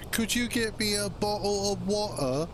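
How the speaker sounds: hammed-up British accent, the way Americans mock Brits. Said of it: "the British accent".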